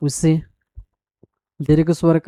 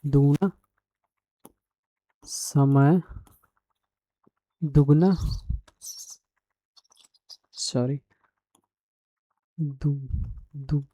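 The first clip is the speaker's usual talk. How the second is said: slow, word-by-word dictation, softer; 4 dB quieter, much slower than usual. Shown as a demonstration. Teacher dictating the definition to students, slow and measured. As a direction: spell it out slowly so students can copy.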